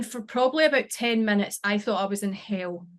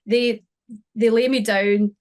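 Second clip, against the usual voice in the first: usual speaking voice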